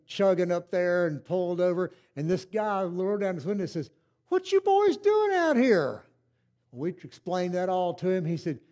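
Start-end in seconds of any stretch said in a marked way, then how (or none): 4.3-6.0 put-on high, slow old country voice calling out; stranger's drawl. Old driver asks stranded boys, high slow country voice. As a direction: voice the old driver leaning out his window. Stranger calls from car window.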